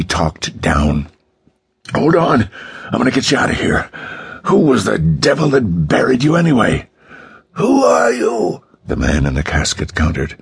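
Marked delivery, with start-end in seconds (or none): 1.9-6.9 urgent, high-pitched call. A narrator's reading. King talking down to the buried man as he digs, calling urgently. King calls down while digging. 7.5-8.6 very high, slow, squeezed question; strained. A narrator's reading. Man in the casket countering his rescuer, strained and slow. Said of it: "strained voice".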